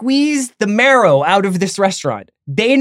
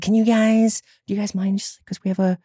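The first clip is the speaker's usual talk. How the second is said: hushed, hesitant hostess asking a favor; 4 dB quieter, faster than usual. Retold or imitated. Hostess gently asking diners to give up their table, hushed hostess voice. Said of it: "That's the hostess voice".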